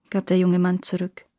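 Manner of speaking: ordinary delivery, nothing marked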